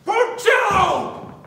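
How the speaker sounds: slow, furious yell across the room; angry shout. Acted. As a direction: call him out in rage. Angry boss calls the thief.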